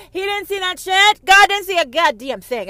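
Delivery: high, loud shout. Real, not acted. Ranting at the audience about God, shouting. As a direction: yell it at the crowd. Rant climax.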